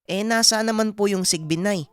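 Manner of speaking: puzzled son in a high voice; Aming. Acted. (nagtatakang binata, mataas ang boses)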